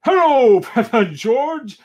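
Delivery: high, loud, jolly hail; booming Santa cry. Acted. Santa greeting Peppa and George, loud hearty cry. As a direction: call out big like Santa at the door. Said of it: "cries Santa".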